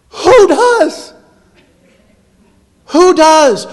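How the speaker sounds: high, loud rhetorical question; exasperated challenge. Acted. Preacher challenging the congregation's excuse, exasperated. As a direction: demand it in mock disbelief.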